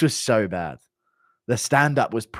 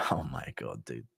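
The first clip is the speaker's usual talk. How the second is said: ordinary talk, nothing marked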